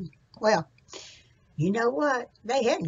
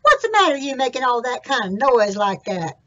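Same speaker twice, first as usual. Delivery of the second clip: high, loud called question; louder Jack asking the donkey what's wrong; Jack calling out; 3 semitones higher, 8 dB louder than usual. Acted. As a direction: call over to the donkey.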